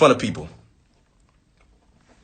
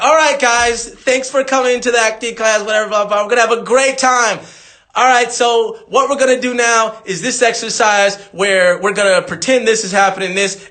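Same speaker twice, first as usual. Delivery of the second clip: high, bright-toned teacher welcoming the class; acting teacher imitation, pitched up; perky acting instructor; 7 semitones higher than usual. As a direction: play the upbeat acting coach greeting students. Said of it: "she would always walk out and be like".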